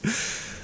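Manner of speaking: quiet airy chuckle; a hushed little laugh; breathy amused exhale; soft breathy laugh. Acted. Teasing partner.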